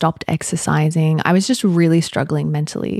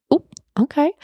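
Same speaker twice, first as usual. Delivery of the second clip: pitched-up, disarmed "oh, okay"; surprised; 10 semitones higher than usual. Retold or imitated. Taken aback by her partner's calm reply, surprised.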